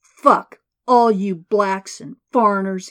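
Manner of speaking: screaming, spitting the words; furious scream. A narrator's reading. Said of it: "he screamed".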